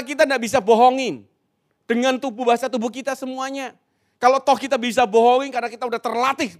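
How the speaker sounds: high, loud rallying delivery; seminar berapi-api. Real, not acted. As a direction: drive the point home at full force to the hall. (berseru berapi-api dengan suara tinggi lantang)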